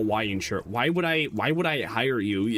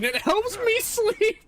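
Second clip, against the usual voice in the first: high put-on voice; slower than usual. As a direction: slip into a high, sleepy mock femboy voice. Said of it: "not gonna continue talking like that".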